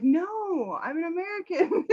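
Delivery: high, giggling protest; laughing reply. Real, not acted. Laughing at being mistaken.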